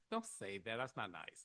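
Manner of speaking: hushed retold telling-off; gentle scolding. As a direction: drop the voice and chide softly. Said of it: "And I was like".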